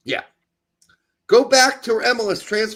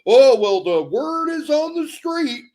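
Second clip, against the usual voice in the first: mocking quote; 7 semitones higher, 5 dB louder, slower than usual. Mocking a pundit's vague source.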